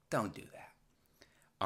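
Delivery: hushed, slow whisper; slow hushed whisper of a warning. Acted. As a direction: breathe it out like a secret warning. Whispered aside after warning.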